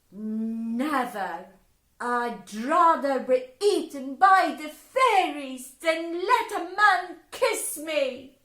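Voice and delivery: loud, high flat refusal; loud, high girl refusing a kiss; indignant refusal. Acted. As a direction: refuse him outright.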